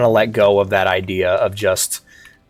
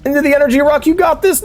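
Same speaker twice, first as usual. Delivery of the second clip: high excited cheering; 13 semitones higher than usual. Real, not acted. Cheering character on.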